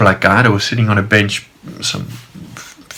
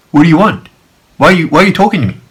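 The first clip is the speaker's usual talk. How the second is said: higher-pitched, irritated retort; irritated challenge; 6 semitones higher, 3 dB louder, much faster than usual. Retold or imitated. Annoyed man confronts park guard, irritated. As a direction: retell a testy challenge to the man. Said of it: "this guy's just pissed me off".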